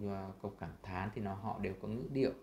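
ordinary talk

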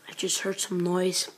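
ordinary talk

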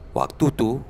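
usual speaking voice

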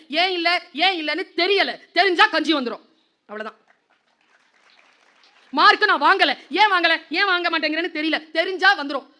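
high and loud, driving each question home; fervent exhortation. Real, not acted. Teacher urging students about marks, loud high preaching. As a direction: preach at full force.

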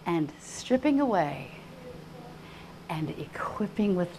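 soft, slow, with long pauses; quiet, weighty awe; hushed reverence. Real, not acted. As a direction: let it sink almost to a whisper. Hushed testimony after outcry.

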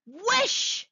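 high, rising cry; insistent command. A narrator's reading. Wife pressing her reluctant husband, insistent cry. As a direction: repeat the order insistently, sweeping upward. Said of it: "repeated his wife".

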